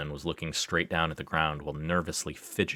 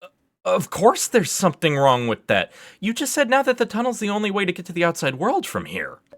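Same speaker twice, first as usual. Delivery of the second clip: high, loud, incredulous questioning; exasperated, voice pitched way up; worked-up outburst; 12 semitones higher, 7 dB louder than usual. Acted. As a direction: fire off disbelieving questions at full pitch. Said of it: "got a bit worked up".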